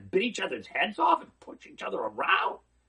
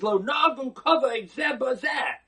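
proclaimed quote; 6 semitones higher, 4 dB louder than usual. Real, not acted. Rabbi quoting the Talmud.

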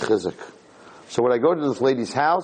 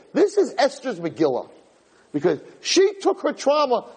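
rising, much higher fervent preaching; impassioned preaching; 9 semitones higher than usual. Acted. Rabbi preaching to the room about Esther, impassioned. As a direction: drive the point home to the crowd.

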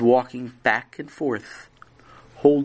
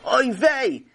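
high-pitched cry of dismay; 10 semitones higher than usual. A narrator's reading. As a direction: exclaim in alarm, voice raised. Mother dismayed at deep snow.

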